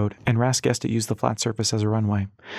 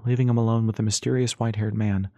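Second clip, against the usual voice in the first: ordinary delivery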